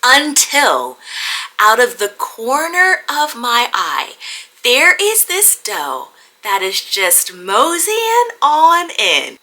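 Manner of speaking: slow, drawn-out suspense. Real, not acted. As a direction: let the moment hang slowly. Building suspense before the shot.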